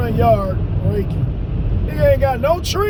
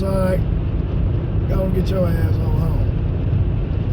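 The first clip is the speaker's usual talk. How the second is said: stern grandma; 5 semitones lower, slower than usual. Retold or imitated.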